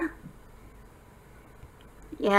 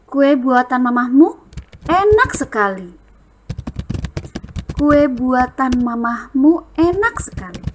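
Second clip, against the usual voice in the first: bright admiring praise of mum's cake; praising tone; 3 semitones higher than usual. Shown as a demonstration. Teacher modelling praise for a cake. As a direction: say it like a sincere compliment. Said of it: "Itu memuji kue buatan mamah".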